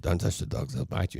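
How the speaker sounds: hushed repeat of a warning; soft-spoken. Retold or imitated. Dog owner repeating his warning to a lady, softly. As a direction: imitate a soft-spoken man, keeping it quiet. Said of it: "he used to speak very softly".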